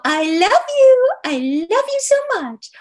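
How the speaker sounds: put-on very high, loud sing-song baby talk; cooing to a dog. Shown as a demonstration. Talking sweetly to her dog, high cooing baby talk. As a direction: coo it like you're loving on a puppy. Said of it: "if I were talking to my dog and I go".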